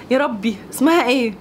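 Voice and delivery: ordinary delivery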